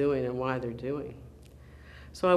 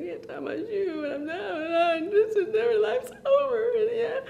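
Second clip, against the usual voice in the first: high-pitched mock bawling of a little kid; crying and wailing; 3 dB louder than usual. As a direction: imitate a child sobbing too hard to talk. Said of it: "I was crying and crying".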